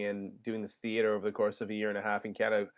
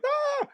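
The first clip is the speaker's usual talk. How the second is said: startled yelp. Retold or imitated. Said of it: "I was like".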